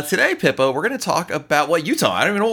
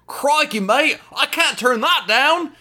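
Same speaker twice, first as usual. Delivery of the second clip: slow, high hammed-up Australian accent; a bad Aussie impression, stretched out and pitched up; mock Australian accent; 12 semitones higher, slower than usual. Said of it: "a classic Australian accent".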